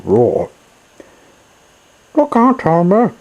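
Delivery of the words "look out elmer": high, loud little bird voices warning him; birds calling out. Acted. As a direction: squeak out a frantic warning like small birds. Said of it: "the birds and small creatures called out".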